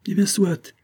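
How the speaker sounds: a warm burst of yielding; effusive. A narrator's reading. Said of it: "dit-il enfin avec expansion". (Chavigny cède avec effusion, voix plus aiguë)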